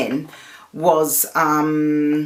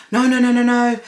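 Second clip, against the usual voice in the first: loud, high flustered denial; mock the shopkeeper's flustered no no no; protesting no; 7 semitones higher, 4 dB louder than usual. Shopkeeper protesting accusation of racism.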